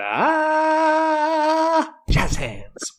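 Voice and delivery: a high, loud showy "Ah" into jazz hands; jazz hands finish. Retold or imitated. Family ending their act for the agent with a showy flourish. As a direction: hit a ta-da stage flourish. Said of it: "standing there going".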